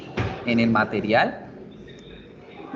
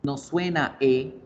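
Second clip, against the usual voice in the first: ordinary talk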